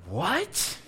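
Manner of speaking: high, stunned what; shocked. Retold or imitated. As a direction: react in disbelief, pitch jumping up. Hearing the twins news.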